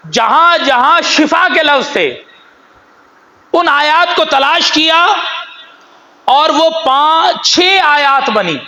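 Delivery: rising fervor. A narrator's reading. Preacher building intensity in story.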